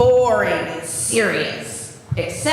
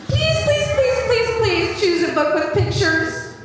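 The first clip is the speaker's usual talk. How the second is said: high pleading please; hammed-up high-pitched pleading; mock begging; 6 semitones higher than usual. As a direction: beg the kids dramatically. Begging kids to choose other book.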